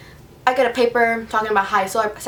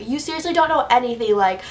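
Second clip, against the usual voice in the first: ordinary talk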